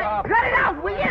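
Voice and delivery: high-pitched demand to stop; exasperated protest. Acted. Friend stopping two bickering pals, exasperated. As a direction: break up the bickering, voice shooting up. Breaking up pink-purple squabble.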